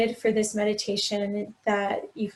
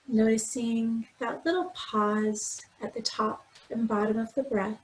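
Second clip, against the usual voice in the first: guided meditation; 4 dB quieter than usual. Acted. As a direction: gently guide them through breath and body, unhurried. Leads a body scan meditation.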